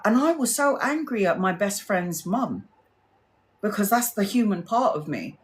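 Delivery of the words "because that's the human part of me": ordinary talk, nothing marked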